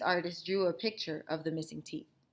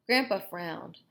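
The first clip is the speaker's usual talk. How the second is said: ordinary delivery, nothing marked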